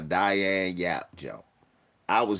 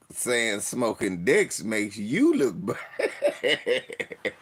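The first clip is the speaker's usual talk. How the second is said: words broken by laughter; laughing at his own explanation; laughing through it; slower than usual. Real, not acted. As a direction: laugh while you finish the line. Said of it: "They was laughing like".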